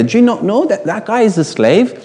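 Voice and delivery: usual speaking voice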